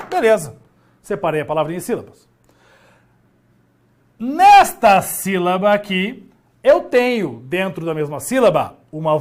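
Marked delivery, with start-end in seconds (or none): none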